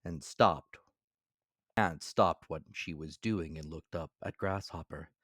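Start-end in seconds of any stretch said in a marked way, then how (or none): none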